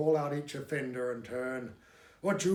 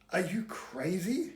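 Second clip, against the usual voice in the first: ordinary delivery, nothing marked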